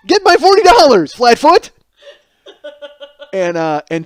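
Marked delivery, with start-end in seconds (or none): 0.1-1.7 high, loud hammed-up demand; outraged yell. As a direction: bellow an order at the cop. Yelling demand at the cops.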